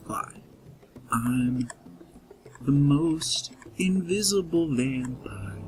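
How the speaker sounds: whispering vampire. Pretend.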